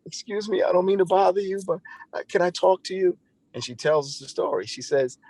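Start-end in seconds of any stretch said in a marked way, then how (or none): 0.0-3.2 high voiced woman. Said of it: "And she's like".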